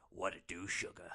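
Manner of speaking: a worn-out hustler sweet-talking; low streetwise greeting. Acted. Old hooker greeting the car, low put-on voice.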